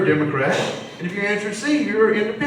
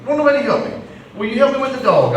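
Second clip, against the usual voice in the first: imitating a huffy wife, high-pitched complaint; mock huffy plea for help around the house; huffy puffy wife; 8 semitones higher than usual. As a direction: complain high, voice swooping down at each phrase end. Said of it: "she's huffy puffy".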